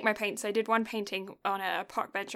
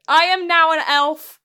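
loud, high, proud elf lord; grandiose elf; 7 semitones higher, 12 dB louder than usual. Pretend. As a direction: proclaim yourself an elf. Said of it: "I always feel like I'm larping".

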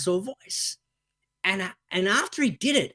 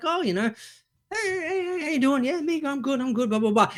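high, playful toy chatter; child playing with toys, lightly voiced; toy voices; 9 semitones higher than usual. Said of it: "You're like".

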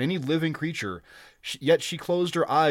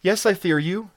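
high, louder anxious woman's voice; distracted fear; 7 semitones higher, 6 dB louder than usual. A narrator's reading. Captive admitting fear to the barbarian, distraught. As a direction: blurt the admission out in a raised pitch. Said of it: "she replied, too distracted to dissemble".

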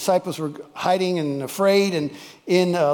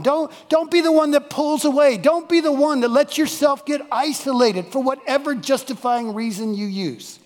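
higher, loud, urgent exhortation; fervent preaching; 7 semitones higher, 4 dB louder than usual. Real, not acted. Preacher urging the congregation not to wander, loud fervent plea. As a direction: plead with them not to wander off.

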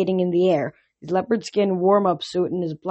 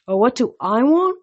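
higher, indignant echo; mocking echo; 5 semitones higher, 4 dB louder than usual. A narrator's reading. God echoing the boy's question back, mocking. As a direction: throw the question back with mockery.

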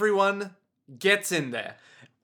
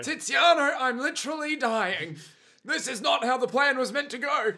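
high, whiny dying complaint; mock dying villain; 7 semitones higher than usual. Wounded villain whines to his partner while dying. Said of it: "the bad guys are like".

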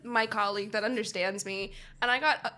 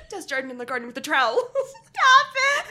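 fast riff breaking into laughter; cracking up; 6 semitones higher, 7 dB louder than usual. Real, not acted. Riffing on the garden joke, cracking up. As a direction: rattle it off, dissolving into laughter.

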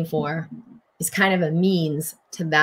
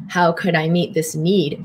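normal talking voice

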